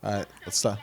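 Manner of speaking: ordinary delivery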